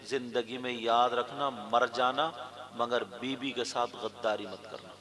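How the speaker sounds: low, soft, solemn counsel; earnest hushed preaching. Real, not acted. Preacher pleading with young men to stay faithful to wives, earnest preaching.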